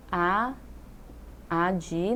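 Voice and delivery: first syllable "a de" said alone; split into syllables. Shown as a demonstration. Teacher dividing advogado, "a de" said separately. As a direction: isolate "a de" as the opening syllable. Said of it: "São quatro sílabas".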